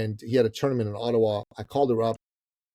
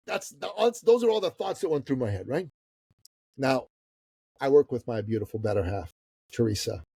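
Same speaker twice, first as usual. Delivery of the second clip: normal talking voice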